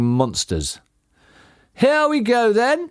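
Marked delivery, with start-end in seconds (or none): 1.7-2.9 shouted. A narrator's reading. Bean sets the digging machines going, shouting. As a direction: call out to start the charge. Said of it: "shouted Bean".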